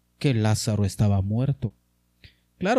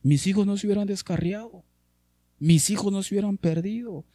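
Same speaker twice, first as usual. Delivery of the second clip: regretful parents; 6 semitones higher, faster than usual. Retold or imitated. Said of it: "algunos padres de familia".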